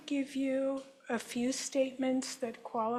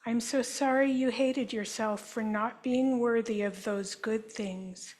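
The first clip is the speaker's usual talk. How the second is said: guided healing affirmation. Acted. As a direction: offer each apology gently, like a therapist. Statements for group to repeat.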